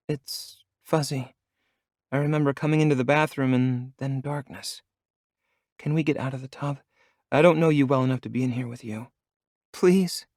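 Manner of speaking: high, trembling and pleading; shaken, begging to get out. A narrator's reading. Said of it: "she asks with a trembling voice".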